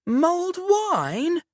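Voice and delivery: very high, slow, stretched "Mulled wine?"; excited exclamation. Acted. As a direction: leap up on "wine" like it's the best news all day. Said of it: "Robin exclaims".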